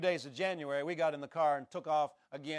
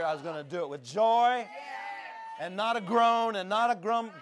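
higher, louder preaching surge; preaching at full force; 6 semitones higher, 5 dB louder than usual. Acted. Preacher vowing before the congregation, full force. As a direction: lift into a ringing declaration.

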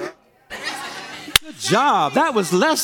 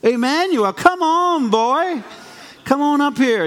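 high, bright calling out; calling out warmly; 11 semitones higher than usual. Real, not acted. Pastor calling a little boy up to the platform, high and eager.

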